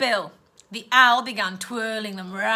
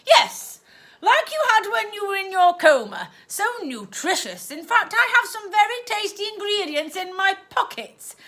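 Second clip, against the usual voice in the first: much higher and louder, gushing; aunt cooing about shakes; 8 semitones higher, 5 dB louder than usual. Acted. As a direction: sell the revolting treat sweetly.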